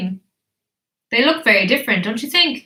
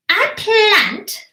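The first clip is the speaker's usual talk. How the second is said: high-pitched, incredulous outcry; horrified yell; 11 semitones higher than usual. A narrator's reading. Mum hears her baby wants plants, horrified. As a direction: squeal it as a scandalised mum. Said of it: "yelled Mum in horror".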